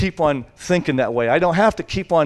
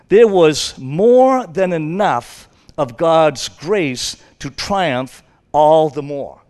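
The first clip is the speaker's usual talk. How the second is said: slow, loud proclamation; drawn out, louder triumphant reading; emphatic scripture; 2 semitones higher, 7 dB louder, much slower than usual. Acted. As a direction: proclaim each word slowly and loudly.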